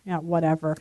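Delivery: low, quiet imitation of a scoffer; mock dismissive scoff. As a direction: puff dismissively, then drop low under your breath. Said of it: "just starts scoffing".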